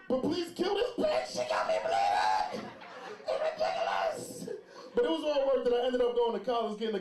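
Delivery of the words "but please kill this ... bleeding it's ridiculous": slow, screamed, hammed-up prayer with wild pitch jumps; hysterical pleading. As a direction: wail a desperate prayer at full tilt. Acted hysterical prayer while bleeding.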